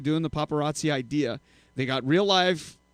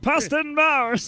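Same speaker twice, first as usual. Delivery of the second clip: high, slow goofy announcer voice; funny voice; 11 semitones higher, 4 dB louder, slower than usual. Acted. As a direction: stretch it out in a silly high voice. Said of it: "You gotta give some kind of funny voice".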